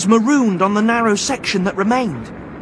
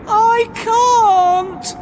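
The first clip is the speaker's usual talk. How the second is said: high, loud, tearful wail; 5 dB louder than usual. Acted. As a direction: wail in despair. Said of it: "wailed Sylvie".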